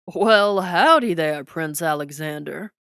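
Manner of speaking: loud, hearty Texas drawl of a politician; booming Texas twang. A narrator's reading. A Texas president greets the prince with booming twang. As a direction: boom out a big Texas howdy. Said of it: "booming voice held a distinct Texas twang".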